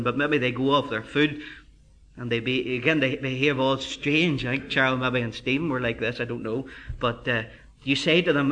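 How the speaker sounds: ordinary delivery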